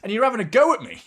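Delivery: imitating a furious teammate shouting back; retold angry rant. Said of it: "starts screaming at him".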